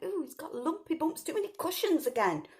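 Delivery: a hint of a complaining little girl; Goldilocks. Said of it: "and she went".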